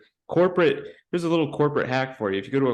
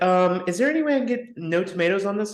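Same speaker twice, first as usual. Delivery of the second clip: whiny customer; 7 semitones higher than usual. Pretend. Mock customer voice.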